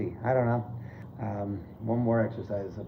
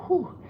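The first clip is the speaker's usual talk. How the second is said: sharp high whew of exhaustion; breathless whew. Real, not acted. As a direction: blow out air after the set. Winded mid-workout exhale.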